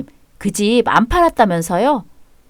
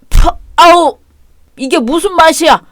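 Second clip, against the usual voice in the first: spitting in disgust; 6 semitones higher, 4 dB louder than usual. Acted. Father spits out the coffee.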